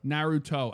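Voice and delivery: "Naruto" pronounced with a hard "toe" at the end; Naruto, last syllable a clear "toe". Shown as a demonstration. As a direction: say Naruto with a crisp T, ending "toe". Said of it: "I know it has a toe".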